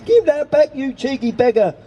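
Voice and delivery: put-on high-pitched outraged old lady's cry; shocked indignant shout. A narrator's reading. Old lady to the gull stealing her fish, shocked shout. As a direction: call out in shocked protest, voice pitched right up. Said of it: "shouted the shocked old lady".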